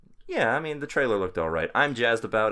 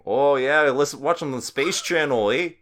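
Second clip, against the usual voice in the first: put-on Canadian accent; 6 semitones higher, 6 dB louder than usual. Mock Canadian speaker.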